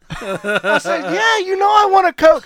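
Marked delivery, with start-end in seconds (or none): 1.1-2.4 much higher, louder eager yes; high, loud, delighted yes. Retold or imitated. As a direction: jump at the offer. Eager reply to offered Coke.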